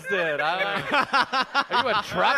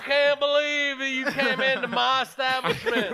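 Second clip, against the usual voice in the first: put-on Merle Southern accent; 4 semitones higher than usual. Guy in character as a hairdresser scolding a client, Southern accent. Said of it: "Let me do it again".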